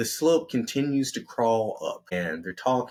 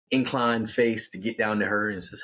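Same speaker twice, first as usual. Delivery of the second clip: ordinary delivery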